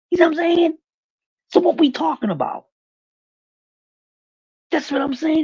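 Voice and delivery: usual speaking voice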